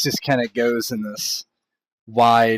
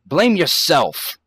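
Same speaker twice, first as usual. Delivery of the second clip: higher, louder in-character protest; acted huffy woman, pitched up; dismissive indignation; 5 semitones higher, 4 dB louder than usual. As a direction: shrug off the question, affronted, and throw the blame back. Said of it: "she kind of shrugs".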